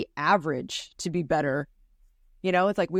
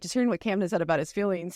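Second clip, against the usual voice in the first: ordinary talk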